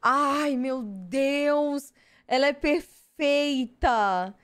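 drawn-out fangirl squeal; gushing delight. Real, not acted. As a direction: swoon over the perfect card. Gushing over a favourite card.

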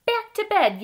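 high, loud storybook yell. A narrator's reading. Ted shouts to Fred about switching rooms, high excited yell. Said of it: "yelled Ted".

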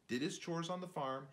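plain reading voice

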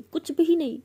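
escalate, yell "कुछ भी नहीं" full force. Retold or imitated. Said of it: "ऐसे बहुत ज़ोर से चिल्लाते".

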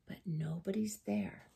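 hushed storyteller whisper. A narrator's reading. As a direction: whisper it for suspense. Suspense in the story.

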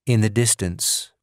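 usual speaking voice

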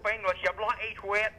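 indignant mock Australian accent; Australian-sounding English accent. Caller insisting on being English, fake Australian accent. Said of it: "talking with an Australian accent".

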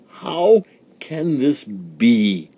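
slow, drawn-out demand; slow demanding stepmother in a changed voice. A narrator's reading. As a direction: stretch out the stepmother's question in disbelief. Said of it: "she demanded".

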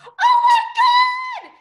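very high, loud squeal; excited scream. A narrator's reading. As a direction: scream it slowly with joy. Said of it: "the others screamed".

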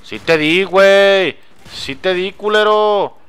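high, loud angry yell; angry shout. Real, not acted. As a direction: shout it at the screen. Angry at enemy surviving.

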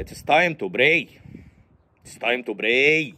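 slow, solemn announcement with b for p in pray; mispronounced English. Acted. Respectable man addressing foreigners in a meeting, mispronounced English. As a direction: PRAY said as "bray", long and slow. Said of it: "pray يعني ينهق يا حبيبي".

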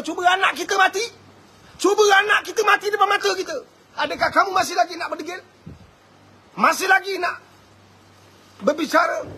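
fiery challenge. Real, not acted. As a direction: challenge the crowd hotly.

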